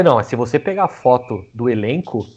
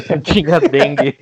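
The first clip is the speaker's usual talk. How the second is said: laughing; 4 semitones higher than usual. Real, not acted. Laughing repeat of a teammate's joke.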